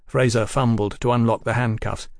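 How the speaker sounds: ordinary delivery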